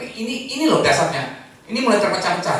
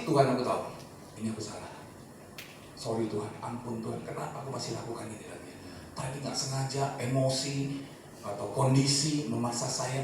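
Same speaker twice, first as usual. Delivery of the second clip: softer, fading to a whisper by the end; hushed confession; 4 semitones lower, 8 dB quieter than usual. Retold or imitated. Preacher voicing his own prayer, softer and softer. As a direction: murmur a private confession to God. Quoted private confession to God.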